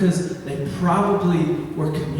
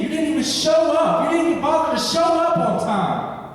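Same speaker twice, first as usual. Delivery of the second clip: higher, rising to a near-sung cry at God; anguished complaint; 6 semitones higher than usual. Acted. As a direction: pour out hurt at God, building in pitch. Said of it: "So when I'm reading this".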